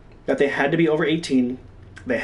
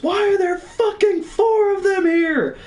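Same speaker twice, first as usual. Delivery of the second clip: very high, slow, strained yell; hammed-up top-of-the-lungs scream; re-enacted scream; 3 dB louder, slower than usual. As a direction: scream each word out, stretched and high. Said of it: "I scream at the top of my lungs".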